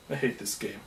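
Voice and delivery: very soft, low mutter; muttered grumble. Retold or imitated. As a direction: grumble it under your breath, low. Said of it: "you'll frequently hear me go".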